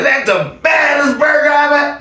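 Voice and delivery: high, shouted mock of Tim; mocking Tim's phrasing. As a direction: ham up the silly line. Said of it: "Most people don't talk like that".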